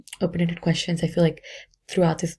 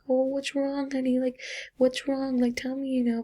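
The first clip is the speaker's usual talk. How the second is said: put-on babying voice; 7 semitones higher than usual. Shown as a demonstration. Teacher's aide babying a whining little girl, high coo. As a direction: coo at her like she's a baby. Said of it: "didn't talk to her like a baby".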